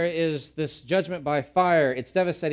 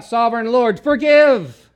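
loud, high anguished cry; 10 semitones higher, 15 dB louder, slower than usual. Acted. Amos crying out to God over the stripped land, anguished loud plea. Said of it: "And he cried out".

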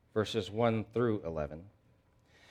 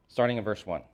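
ordinary talk, nothing marked

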